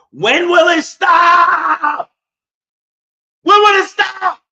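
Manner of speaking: high, loud, drawn-out cry of despair; anguished yell. Real, not acted. Fed-up Knicks fan yelling at the heavens. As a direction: wail it out at the sky.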